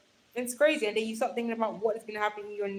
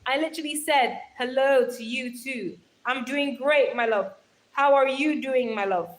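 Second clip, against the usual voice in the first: ordinary talk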